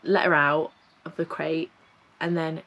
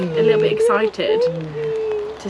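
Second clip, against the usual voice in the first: much higher, slower joyful voice; delighted; 5 dB louder, slower than usual. Real, not acted. Owner gushes that the puppy is happy, high and delighted. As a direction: gush with relief and joy.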